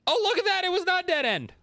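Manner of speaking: high, loud delighted discovery; gleeful, caught off guard; excited surprise. Real, not acted. Surprise at finding a path.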